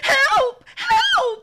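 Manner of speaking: high, loud panicked shouts; shouting for help. A narrator's reading. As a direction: scream for help as she runs.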